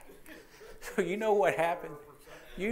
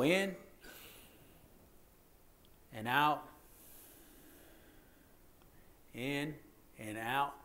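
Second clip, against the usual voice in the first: drawn out in and out; slow breathing demo; 2 semitones lower, 6 dB quieter than usual. Shown as a demonstration. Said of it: "breathe in slowly for a count of four".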